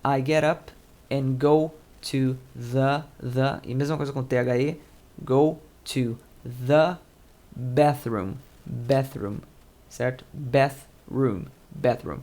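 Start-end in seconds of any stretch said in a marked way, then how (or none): 0.0-3.6 slow model reading. Shown as a demonstration. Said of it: "atenção pra pronúncia". 5.2-8.3 "the" and "bathroom" with the th said with the tongue between the teeth; slow, tongue out on each th of "the bathroom". Shown as a demonstration. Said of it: "língua entre os dentes".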